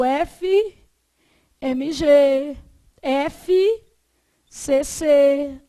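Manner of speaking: "o F, M, G, F, C, C" slowly as separate letter names; spelled out. Shown as a demonstration. Teacher showing acronyms said one letter at a time, spelled out. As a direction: spell "o F, M, G, F, C, C" deliberately, pausing between groups. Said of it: "você fala uma letra de cada vez".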